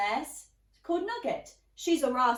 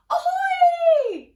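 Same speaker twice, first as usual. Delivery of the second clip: pirate shout; 15 dB louder than usual. Acted. Pirate boy greeting.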